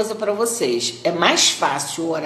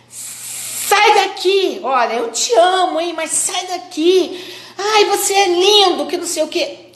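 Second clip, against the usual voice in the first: harsh tone; 8 semitones higher than usual. Shown as a demonstration. Shooing the puppy away with loving words but a harsh tone. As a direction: snap it out so the loving words sound like a scolding. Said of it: "e você fala assim".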